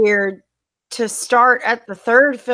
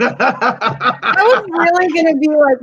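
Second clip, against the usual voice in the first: laughing; 6 semitones higher, 3 dB louder than usual. Real, not acted. Teasing friend about snacking.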